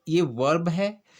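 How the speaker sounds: ordinary talk, nothing marked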